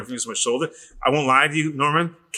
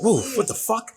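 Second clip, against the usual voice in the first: startled; 10 semitones higher than usual. Retold or imitated. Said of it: "I was like".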